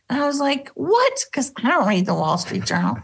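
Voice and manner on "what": high, startled what; a sudden jump of surprise up high; retold disbelief, pitched up; surprised what.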